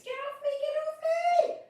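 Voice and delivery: very high, loud panicked scream; frightened screaming. Acted. Little blossom covered in spiderlings, panicked scream. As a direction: shriek it, desperate to get them off. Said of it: "they soon heard screams".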